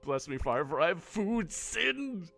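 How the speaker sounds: slow, solemn confessional tone; mock confession. Player confessing food sins to the friar, mock solemn. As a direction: intone it like a parishioner in the booth. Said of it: "I'm just playing along with this guy".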